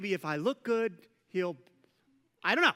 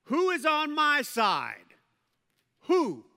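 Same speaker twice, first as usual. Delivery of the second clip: loud, slow, higher call up to the window; 6 semitones higher, 5 dB louder, slower than usual. Acted. Jehu calls up to the servants at the window, loud slow call. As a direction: shout it up slowly, rallying allies. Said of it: "and called out".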